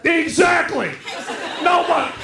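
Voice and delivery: comic delivering the punchline, excited. Real, not acted. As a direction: shout the answer to his own question. Punchline to the crowd.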